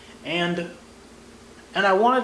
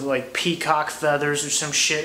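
ordinary delivery

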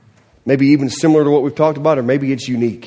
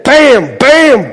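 ordinary delivery

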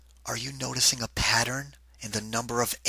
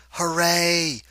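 higher, louder hooray cheer; cheering ants; 6 semitones higher, 4 dB louder than usual. A narrator's reading. Ants cheering as they spot the cake, bright hooray. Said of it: "ants yelled".

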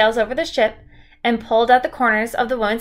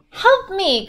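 crying out; 9 semitones higher than usual. A narrator's reading. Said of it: "Kristen cried".

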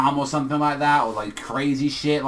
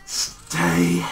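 drawn-out, breathy whisper; shaking deep breath; 4 semitones higher, much slower than usual. Acted. Reviewer acting out Shigaraki shaking before he snaps, slow breathy whisper. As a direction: whisper it very slowly on a heavy breath. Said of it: "it's like, deep breath".

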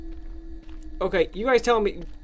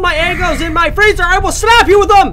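loud, high raging threats; real mock-furious yelling; furious shout; 7 semitones higher, 7 dB louder than usual. Real, not acted. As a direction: rant at Billy at full volume.

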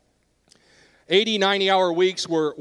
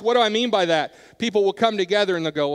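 ordinary delivery

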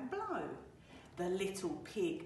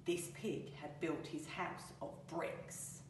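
plain reading voice

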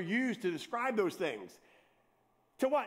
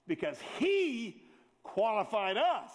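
slow emphatic; slower than usual. Acted. Emphatic key phrase, slowed.

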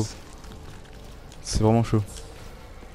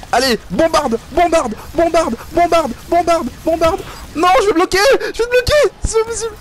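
frantic yelling. Real, not acted. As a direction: urge them on in panic. Frantic calls in boss fight. (cris frénétiques et aigus)

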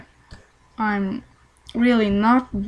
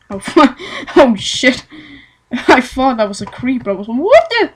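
startled; 2 semitones higher, 5 dB louder than usual. Real, not acted. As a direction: jump at a sudden scare. Startled by a creeper.

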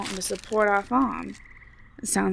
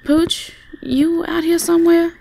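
calling out; 8 semitones higher, 3 dB louder than usual. Acted. Calling outside for pet.

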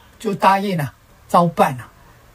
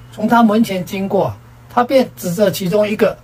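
plain reading voice

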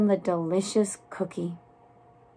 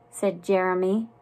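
ordinary delivery, nothing marked

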